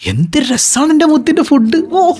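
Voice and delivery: praising the food. Retold or imitated.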